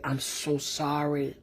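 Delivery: hushed, weeping apology to the dead; a soft, broken sorry through tears; tearful apology. Retold or imitated. In the car, apologising to the lynching victims, softly tearful. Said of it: "I cried so hard".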